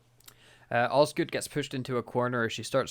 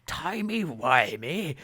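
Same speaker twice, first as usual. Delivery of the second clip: slow, higher, baffled echo of timey-wimey; incredulous repeat; 4 semitones higher, much slower than usual. Retold or imitated. Old Doctor repeating the younger one's silly phrase, slowly baffled. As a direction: drag out timey-wimey in disbelief. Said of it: "John Hurt's like".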